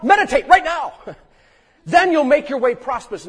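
loud, high, driving sermon delivery; full-force preaching. Acted. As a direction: press the congregation with rising urgency.

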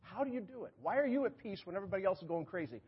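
high-pitched, wondering voice of an amazed world; puzzled onlookers. Acted. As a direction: ask it high and hushed, as baffled onlookers. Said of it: "then the world goes".

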